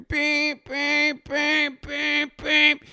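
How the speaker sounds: high flat reversing beeps; mimicking the crane in the parking lot; imitated crane beeping. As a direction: beep like a crane backing up. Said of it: "yelling and beeping".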